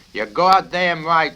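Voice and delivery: loud roar, high and slow. A narrator's reading. Colonel roaring at Clevenger in anger. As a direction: bellow it at the cadet. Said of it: "roared the colonel".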